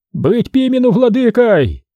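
high-pitched acted shout of support; partisan shout. A narrator's reading. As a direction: cry out for your candidate over the crowd. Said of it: "кричал Дорофей".

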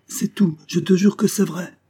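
high, loud, urgent oath; pleading insistence. Acted. Wife swearing innocence to doubting husband, urgent plea.

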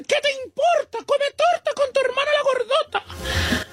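mocking high voice. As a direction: snap back in a sassy falsetto. Quoted operated woman snapping back. (voz aguda y burlona de mujer ofendida)

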